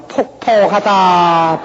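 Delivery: drawn-out, slow wailing 폭폭하다; mock ghost wail. Preacher mimics a western Jeolla ghost, slow wail. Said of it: "서쪽에 가면요".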